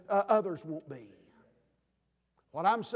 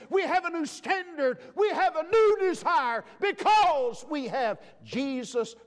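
preaching at full force; 8 semitones higher than usual. Acted. Preacher proclaiming new life to congregation, full-force preaching. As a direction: proclaim it to the whole church.

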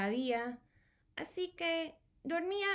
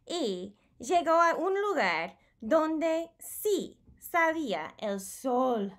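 higher, louder, slow triumphant announcement; big storybook reveal of the sun; 4 semitones higher, 6 dB louder than usual. A narrator's reading. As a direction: proclaim the sunrise to the children.

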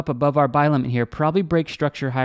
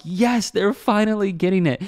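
higher, drawn-out relieved "yes"; mock elation; 5 semitones higher, slower than usual. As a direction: act out a slow, pleased cheer at good news. Acting out glad reaction to emails.

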